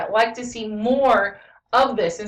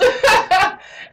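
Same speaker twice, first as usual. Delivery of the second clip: high, loud peal of laughter; real burst of amusement; 12 semitones higher, 7 dB louder than usual. Real, not acted. Laughs at own imitation.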